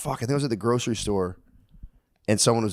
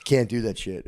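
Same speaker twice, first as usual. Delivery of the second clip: usual speaking voice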